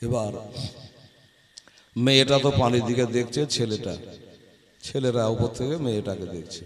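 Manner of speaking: hushed suspense. A narrator's reading. (ফিসফিসে রহস্যময় স্বরে)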